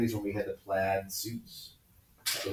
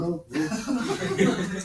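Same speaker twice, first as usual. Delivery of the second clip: mock dread; 9 semitones higher, slower than usual. Voices brother dreading punishment.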